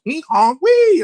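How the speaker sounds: high squeaky honking clown noises; silly clown honks. Retold or imitated. Entertaining his daughter with clown noises, high squeaks. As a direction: honk like a clown nose.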